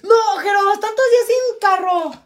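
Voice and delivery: high, loud whining complaint; dismayed protest. Real, not acted. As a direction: wail it in protest. Protests news about the car.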